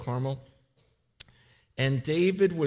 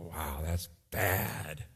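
low, soft, slow wonder; a hushed, awed aside to himself; hushed awe; 8 semitones lower, 7 dB quieter, much slower than usual. Retold or imitated. Pastor reacting to the story, hushed and slow. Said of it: "I go".

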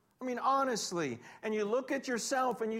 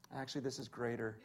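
laughing aside; 10 semitones lower, 8 dB quieter, faster than usual. Real, not acted. Joke comparing gadget, laughing.

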